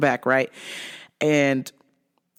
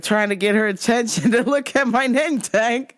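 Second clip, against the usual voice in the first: ordinary talk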